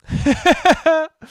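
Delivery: high, loud giggle; real burst of amused laughter. Real, not acted. Laughs with relief at story.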